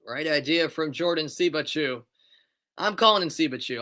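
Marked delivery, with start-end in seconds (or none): 0.0-2.0 brisk, lifted match call; play-by-play. Acted. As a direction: call the free kick live.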